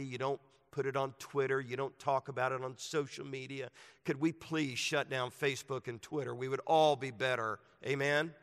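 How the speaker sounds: rousing pulpit delivery; preaching at full force. Acted. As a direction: preach it out to the whole room.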